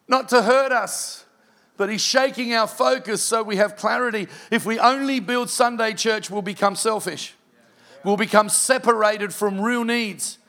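full pulpit preaching. Acted. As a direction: drive the point home to the congregation. Preaching the closing application.

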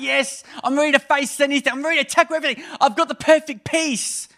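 high, loud, fired-up; pumped up. Retold or imitated. Churchgoer on Sunday morning, high and loud with excitement. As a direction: pump yourself up like a champion. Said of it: "I'm like".

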